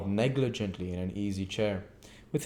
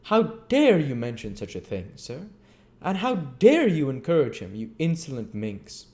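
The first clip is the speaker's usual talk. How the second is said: louder, higher pompous outrage; indignant beadle; 6 semitones higher, 5 dB louder than usual. Acted. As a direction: demand it, affronted. Bumble scolding both.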